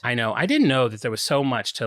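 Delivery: ordinary talk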